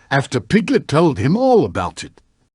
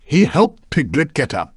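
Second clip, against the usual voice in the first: usual speaking voice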